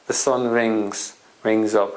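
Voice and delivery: ordinary delivery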